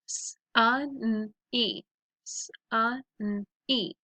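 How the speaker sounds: "sunny" sound by sound, s, u, n, ee; segmented phonics "sunny". Shown as a demonstration. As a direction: sound out "sunny" twice with gaps. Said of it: "So let's practice them".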